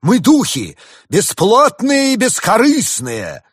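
high, loud indignant proclamation; angry. A narrator's reading. Winter spirit refuses to be bought, angry and loud. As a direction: thunder it out, stretching the last words. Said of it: "рассердился дух зимы".

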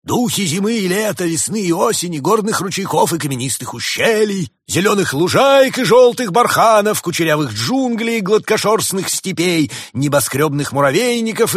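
high, loud, grand roll-call; pompous. A narrator's reading. Summer spirit lists all the waiting spirits, pompous proclamation. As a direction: proclaim the list like a herald, pitch held high. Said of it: "пафосно заявил дух лета".

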